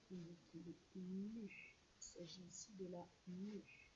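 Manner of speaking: soft, slow hushed telling; hushed storytime voice. Shown as a demonstration. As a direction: drop to a near whisper for the snake. Teacher hushes over snake picture.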